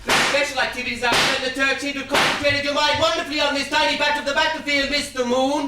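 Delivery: loud declaiming. Acted. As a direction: declaim it loudly over the trench. Calling over after the outburst.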